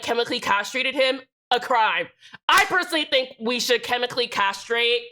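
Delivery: ordinary delivery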